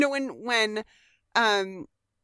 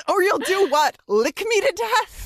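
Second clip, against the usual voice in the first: irritated Giles; 10 semitones higher, 6 dB louder than usual. Retold or imitated. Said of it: "and Giles says".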